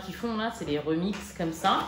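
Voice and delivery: ordinary talk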